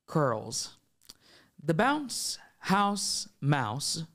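very slow, spaced-out poem recital; reader voice. Pretend. Host reads guest's poem aloud, reader voice. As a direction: give each word its own space. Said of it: "like, with your reader voice".

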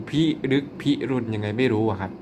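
ordinary talk

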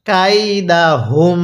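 very slow "কাইদাহুম", long syllables; model recitation. Shown as a demonstration. Teacher has learners read along, very slow "কাইদাহুম". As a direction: stretch "কাইদাহুম" out, humming the final m. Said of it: "হ্যাঁ, পড়ুন".

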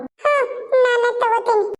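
put-on high, slow child voice; little child. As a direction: answer mother like a small child. Little daughter answers her mother. (ಪುಟ್ಟ ಮಗುವಿನ ಎತ್ತರದ, ನಿಧಾನ ದನಿ)

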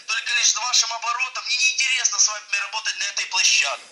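ordinary delivery

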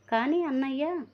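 anxious plea. Acted. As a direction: beg your brother for answers, voice lifted high. Sister pleads with brother.

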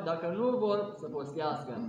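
ordinary delivery

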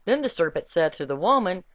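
normal talking voice

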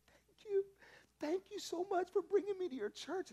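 high, overwhelmed thank-you; grateful roommate. Acted. Roommate hugs him after prophecy, high grateful voice. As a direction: gush thanks through tears.

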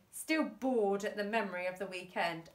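ordinary delivery

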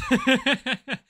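sudden high burst of laughter; loud laugh. Real, not acted.

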